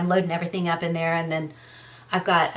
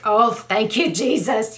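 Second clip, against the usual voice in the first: a burst of relief, raised and louder; relieved cry; 3 semitones higher, 6 dB louder, slower than usual. Retold or imitated. Wife relieved her husband texts, breathless thanks. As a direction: thank heaven someone's awake, let it out.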